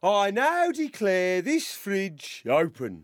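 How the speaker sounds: slow, grand ceremonial proclamation; blustery pompous mayor. Acted. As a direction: declare it like a self-important mayor. Mayor sketch, opening everything.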